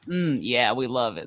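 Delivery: ordinary talk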